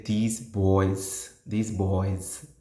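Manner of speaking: put-on "These boys", "boys" closing on a buzzed z; ending with z. Shown as a demonstration. Teacher showing what you should say, z-ending stressed. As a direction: repeat "boys" with a deliberate z at the end. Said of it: "how I ending the word with z".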